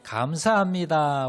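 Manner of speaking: 감사합니다 stretched out loudly; loud greeting. Shown as a demonstration. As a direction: call out your thanks to the whole room. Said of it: "인사하실 때".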